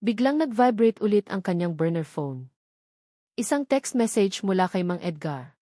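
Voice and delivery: plain reading voice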